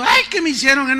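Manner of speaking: a whiny, self-pitying little cry; mock whimpering. Preacher mocks a believer complaining, half-hearted whining. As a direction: whine it like a grown man sniveling. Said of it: "te andes ahí lloriqueando".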